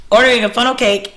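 normal talking voice

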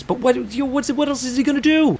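high-pitched rhetorical objection; exasperated protest. Acted. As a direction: argue his case, voice jumping way up. Defends mobster's likely crimes.

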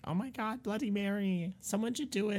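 high, eager egging on; excited friends. Retold or imitated. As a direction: squeal like a thrilled schoolgirl. Friends daring her, retold.

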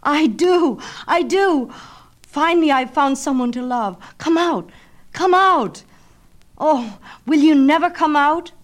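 very high and loud, desperate; frantic longing. Acted. Narcissus begging the reflection to come out, very high and loud. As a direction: cry out to her, aching.

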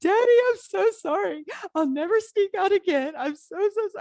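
mock-sobbing teen begging forgiveness; tearful apology. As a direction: wail your sorry at daddy, nearly sobbing. Teen apologizing to father after lecture.

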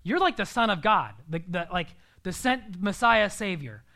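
normal talking voice

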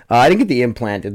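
usual speaking voice

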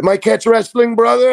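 put-on high, loud Portuguese-accented greeting; Hegan's Portuguese accent. Jiu-jitsu coach cold-calling his new student, high Portuguese accent. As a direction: pitch it up and lean into a Brazilian Portuguese accent. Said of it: "the Portuguese accent".

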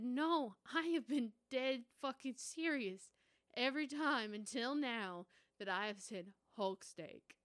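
put-on indignant teen insisting; loud insistence. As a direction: protest hard that you meant it. Insisting to parents she was serious.

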